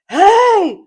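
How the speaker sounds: sudden high yell from the back; guy shouting hey. Retold or imitated. Man at the back of the plane yells, loud. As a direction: bark out a startled hey. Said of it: "Some guy in the back is like".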